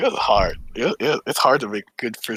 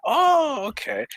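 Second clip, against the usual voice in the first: retold realization; much slower than usual. Learning who the coworker was, mock surprised realization. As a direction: act out the penny dropping.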